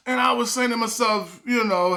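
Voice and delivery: usual speaking voice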